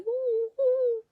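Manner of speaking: high, loud put-on whimpering "ooh, ooh"; hammed-up crying noise to mock herself; mock sobbing. Vlogger mocking her own crying at Idol stories in hammed-up sobs. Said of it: "I'm like".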